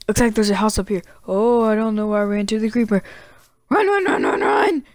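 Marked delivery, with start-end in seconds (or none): 3.7-4.8 high panicked repeated yelps; terrified gibbering. Real, not acted. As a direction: babble it in pure panic. Fleeing mobs, panicked.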